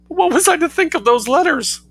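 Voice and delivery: high, pitiful whimper. Acted. Mother pleading with her son, high whimper. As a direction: plead it like a beaten child. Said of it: "whimpered Missus Heasant".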